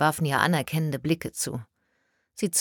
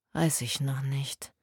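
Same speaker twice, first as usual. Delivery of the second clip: hesitant; 2 semitones lower, 5 dB quieter, slower than usual. Acted. Worried colleague answers quietly.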